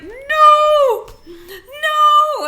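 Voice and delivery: high panicked yelling; panicked shout. Retold or imitated. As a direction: cry out in mock panic. Reenacting getting stuck, shouting.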